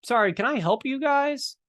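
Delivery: high, silly put-on greeting; goofy salesman. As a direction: act the oddball salesman, pitch way up. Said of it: "he'd say crazy stuff".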